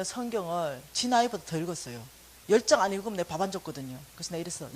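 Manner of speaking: ordinary talk, nothing marked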